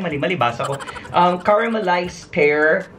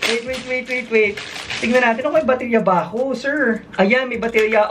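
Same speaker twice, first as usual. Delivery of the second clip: real delight, pitched high; thrilled squeal; 9 semitones higher than usual. Real, not acted. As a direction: shriek with delight at the gift. Thrilled by cute gift in bubble wrap.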